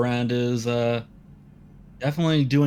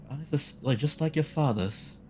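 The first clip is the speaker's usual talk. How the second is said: mild teary high blurt, softer; mother blurting to her son, a touch teary; 6 semitones higher, 3 dB quieter than usual. Acted. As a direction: let it slip out on the edge of tears. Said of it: "teary a little bit".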